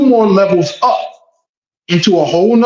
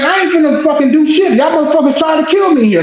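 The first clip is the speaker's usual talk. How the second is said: higher, fast defiant outburst; heated defiance; 9 semitones higher, faster than usual. Retold or imitated. Defendant defying the bailiff reaching for his gun, heated and higher. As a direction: throw it back at him, high and quick. Retold standoff with armed bailiff.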